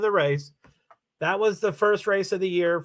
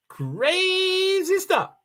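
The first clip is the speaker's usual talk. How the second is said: drawn out; 13 semitones higher, much slower than usual. Real, not acted. Sliding pitch on crazy.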